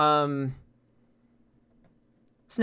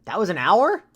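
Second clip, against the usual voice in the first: surprised; 6 semitones higher, 9 dB louder than usual. Real, not acted. Surprise at bell count.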